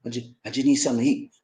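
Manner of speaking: lightly voiced beggar, low and quick; panhandler's plea.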